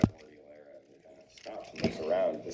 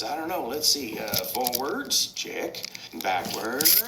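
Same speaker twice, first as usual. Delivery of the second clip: higher cartoon truck thinking aloud; Mater; 6 semitones higher, slower than usual. Truck tests whether he can drive, high character voice. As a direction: pitch up, trying out each gear. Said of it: "Mater says".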